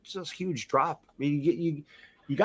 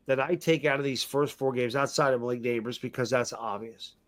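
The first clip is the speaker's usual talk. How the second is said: ordinary delivery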